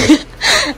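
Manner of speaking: squeaky delighted giggle; a burst of high laughter. Real, not acted. Mother laughs waking child. (tiếng cười khúc khích cao vút)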